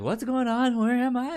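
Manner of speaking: high, bewildered squeak; mock disoriented "where am I"; confused waking. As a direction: play the groggy panic of waking up lost.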